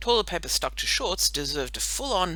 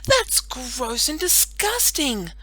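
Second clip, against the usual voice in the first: disgusted; 9 semitones higher, 5 dB louder, much slower than usual. Acted. Disgusted girl character.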